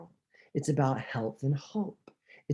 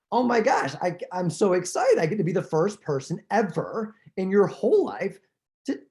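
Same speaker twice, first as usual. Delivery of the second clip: gushing, higher and bubbly; mock excited; 5 semitones higher than usual. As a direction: gush like you can't contain it. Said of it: "I usually say".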